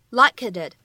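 "like it did" linked, t of "it" dropped; linking, lost plosion. Shown as a demonstration. Teacher showing learners "like it did" linked as one unit. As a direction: join k onto "it", hold the t before "did". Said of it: "存在典型的失去爆破".

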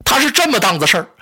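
他是这么档子事儿 rushed out fast, tripping over the words; too anxious to get 这么档子事儿 out clearly, hurry it; flustered gabble. Acted. Sun Quan panics explaining to his mother, fast flustered gabble. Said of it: "孙权一着急说不明白了".

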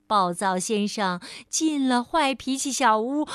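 plain reading voice

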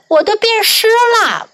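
high whiny complaint; gingerbread man voice. Acted. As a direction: whine in a little high voice.

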